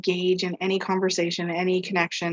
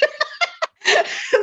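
a high burst of amused laughter; laughing at a self-mocking joke. Real, not acted. Laughs at her own joke.

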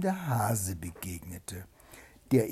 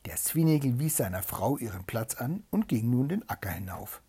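plain reading voice

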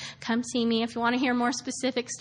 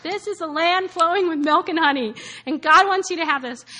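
raised, louder prophetic prayer voice; mild prayer-style proclamation; quoting a prayer; 4 semitones higher, 6 dB louder than usual. Retold or imitated. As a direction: proclaim it like a blessing. Retold prayer quote from friend.

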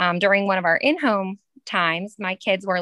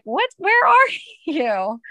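high, louder, drawn-out mock puzzlement with a laugh; imitated bewilderment; 9 semitones higher, 5 dB louder, slower than usual. Teacher imitating her pupils finding her elsewhere, amused bewilderment. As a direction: play baffled kids, stretching the question out.

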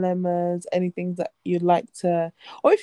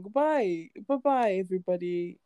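usual speaking voice

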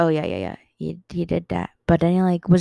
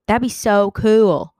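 excited; 4 semitones higher, 6 dB louder, slower than usual. Real, not acted. Imagining callers, repeated two ways.

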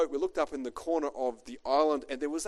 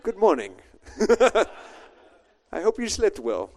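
hammed-up Italian captain, louder; a cheeky half-done Italian accent; 6 semitones higher, 7 dB louder than usual. Captain greeting the cruise passengers in a mock Italian accent. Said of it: "I won't do the accent".

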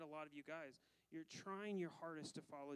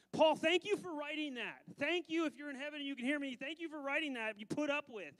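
louder, higher, fervent thanks; 7 semitones higher, 10 dB louder than usual. Real, not acted. Preacher calling up to Paul, fervent and loud.

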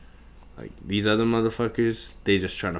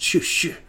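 high, unvoiced whoosh noises; whooshing sounds; 10 semitones higher than usual. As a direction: hiss out the sound of dodging. Imitating sounds of running.

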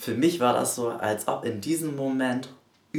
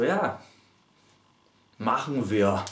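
flippant; 3 dB louder, slower than usual. Retold or imitated. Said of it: "Wenn ihr das so ganz flapsig sagt".

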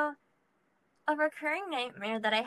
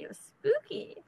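spooky voice; 10 semitones higher than usual. Pretend. Spooky singsong voice.

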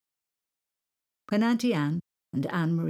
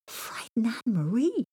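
incredulous gasping question, pitched up; shocked; 6 semitones higher than usual. A narrator's reading. Boy accused of frightening his cousin, shocked disbelief. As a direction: echo it back in disbelief. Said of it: "said Thomas in shock".

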